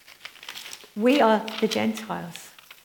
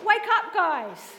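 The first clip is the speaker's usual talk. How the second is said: loud call; 7 semitones higher, 3 dB louder, slower than usual. Real, not acted. Calling out to the congregation.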